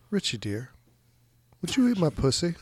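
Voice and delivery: lightly British, rising plea; unintended British accent. Retold or imitated. Host voicing a film character's request, lightly British accent. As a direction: slip faintly into a British accent. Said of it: "She's weirdly British".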